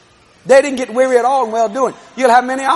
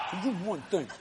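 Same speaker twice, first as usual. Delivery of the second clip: much softer, lower repeat; hushed reply; 5 semitones lower, 19 dB quieter than usual. Retold or imitated. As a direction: drop it almost to a murmur. Quarrel with wife retold.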